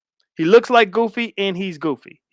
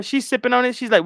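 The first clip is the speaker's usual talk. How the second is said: ordinary talk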